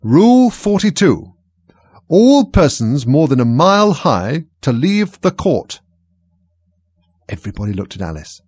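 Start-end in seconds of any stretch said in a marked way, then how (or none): 0.0-5.8 slow, loud, high proclamation; reading out. A narrator's reading. King reading a rule from his book, slow loud proclamation. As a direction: proclaim each word slowly, like a decree. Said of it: "read out from his book".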